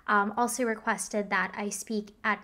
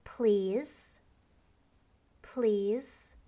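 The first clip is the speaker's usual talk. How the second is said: slow repeated please; much slower than usual. Shown as a demonstration. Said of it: "repeat slowly ten times after me".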